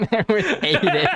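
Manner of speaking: laughing through the words; giggling repeat. Real, not acted.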